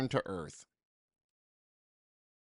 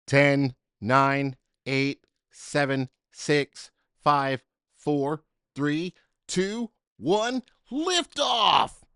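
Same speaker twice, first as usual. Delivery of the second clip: countdown building to liftoff; 6 dB louder, much slower than usual. A narrator's reading. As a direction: count it down, rising to a shouted liftoff. Countdown to rocket launch.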